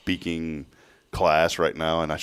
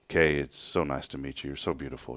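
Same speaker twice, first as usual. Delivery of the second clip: softer, lower mock sweet talk; mock tender; 5 semitones lower, 9 dB quieter than usual. Teasing Rick by voicing his first-date compliment to Kay, softly. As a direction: croon it like a smitten first date. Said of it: "the first thing that Rick says is".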